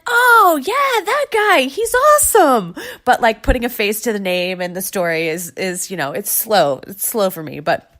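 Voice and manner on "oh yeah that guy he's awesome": very high, loud fake enthusiasm; mock recognition. To her husband, faking that she knows the guy: squealed mock enthusiasm. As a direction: play along as if you totally know him.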